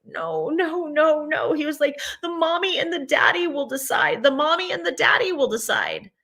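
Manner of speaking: put-on high, childlike pleading voice; high mock child's plea; imitating a crying child. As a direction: voice the upset six-year-old, high and tearful.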